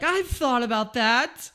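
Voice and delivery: high, loud, hammed-up exasperated rant; jealous would-be inventor. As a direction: whine like someone whose idea was stolen. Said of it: "you were like".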